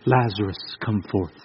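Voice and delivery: tiny hushed whisper, far too quiet; mock whisper. Shown as a demonstration. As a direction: whisper it as if afraid to wake him. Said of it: "Does it say he whispered".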